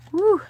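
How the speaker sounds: loud, high "woo"; short, high-pitched whoop; celebratory whoop. Real, not acted. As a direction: let out one bright burst of cheer. Cheers the money counted.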